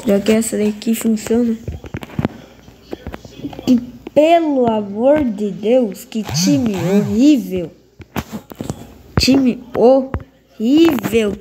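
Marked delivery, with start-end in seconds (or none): 3.7-11.4 raging gamer. Real, not acted. Reclama do time.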